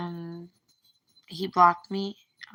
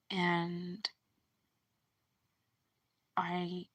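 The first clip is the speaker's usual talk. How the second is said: tender; 6 dB quieter than usual. Retold or imitated. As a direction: say it gently, like a confession. Boyfriend's tender confession retold.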